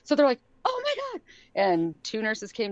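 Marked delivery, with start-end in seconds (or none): 0.6-1.2 much higher amazed "oh my God"; retold high astonished cry; surprised gasp. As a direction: nurses stunned to see him up. Nurses recognize the standing boy.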